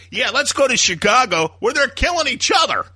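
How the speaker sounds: high, loud, scoffing mock proposal; high-pitched sarcastic jab at the gang idea; sarcastic mock suggestion. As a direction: toss it off as a sneering dare. Mocking a hypothetical gang member.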